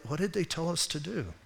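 soft, furtive question to a partner; hushed aside. Retold or imitated. Preacher asks the guy he was with, hushed. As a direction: ask under your breath, having missed it all.

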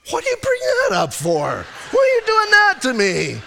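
high, loud, laughing protest; laughing mock outrage. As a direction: yelp in comic indignation at his wife. Mock outrage at wife's remark.

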